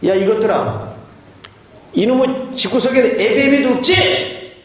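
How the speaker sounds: put-on high, scolding old father; angry father. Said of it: "이 아버지 하시는 말씀이".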